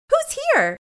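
put-on high small child's voice; child asking her mother who's here; little girl. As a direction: ask it like a little girl running up. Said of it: "this little girl runs up to her".

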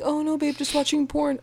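high-pitched mock innocent boyfriend; mock innocent excuse. Voices a man's excuse to partner.

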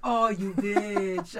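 mock outrage. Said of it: "I'm like".

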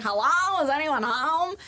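high, loud call into the empty ship. Retold or imitated. Jetpack guy calling into the abandoned ship, high and loud. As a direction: call out to nobody, hopeful. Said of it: "he was like".

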